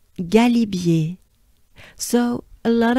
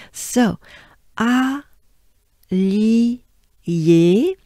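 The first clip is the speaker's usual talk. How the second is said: usual speaking voice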